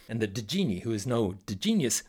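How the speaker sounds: ordinary delivery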